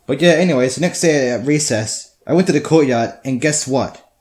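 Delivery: normal talking voice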